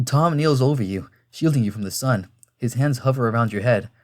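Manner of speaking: plain reading voice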